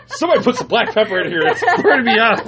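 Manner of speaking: high, laughing mock panic; mock spice-wimp. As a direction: play overwhelmed by pepper, giggling. Mocks friend who fears spice.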